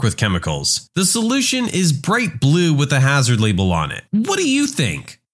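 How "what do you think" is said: high-pitched, incredulous retort; pitched-up rhetorical disbelief; exasperated. Acted. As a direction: toss it back as an obvious answer. Teacher's exasperated answer to silly question.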